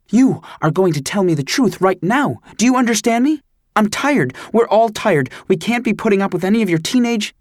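high, tired angry lecture; a tired mother demanding the truth from her son, high and stern; scolding mom. A narrator's reading.

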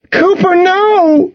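long, high anguished wail. A narrator's reading. Friend crying out to the bleeding dog, wailing. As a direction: wail it out, drawn out. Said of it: "Cassidy wailed".

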